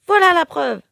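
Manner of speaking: high, loud proof-waving cry; triumphant exclamation. A narrator's reading. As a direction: crow in triumph. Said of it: "s'exclame Carmelita".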